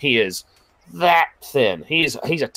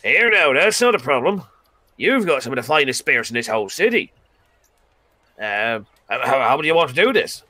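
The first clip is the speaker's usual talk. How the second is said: eager Demonarin; 2 semitones higher than usual. Acted. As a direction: jump at the deal with gusto. Demon brightens at deal.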